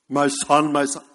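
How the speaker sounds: mock-solemn voice of God; imagined voice of God. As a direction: boom it out like an expected voice from heaven. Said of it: "If you look for that, you may never hear his voice".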